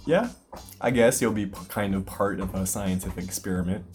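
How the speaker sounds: normal talking voice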